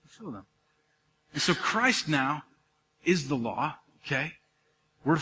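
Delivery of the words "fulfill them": repeated almost in a whisper, falling; soft repeat. Shown as a demonstration. Pastor answering his own question to the congregation, hushed and falling. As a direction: let the answer land softly.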